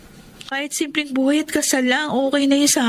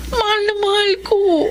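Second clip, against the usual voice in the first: umiiyak; 5 semitones higher than usual. Acted. Pleading lover, crying heard.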